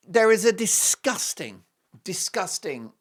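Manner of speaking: high, loud, slow outrage; emphatic indignation. Pretend. Host denouncing agenda against royal couple, emphatic indignation. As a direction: declare it loudly, leaning on each disgusting.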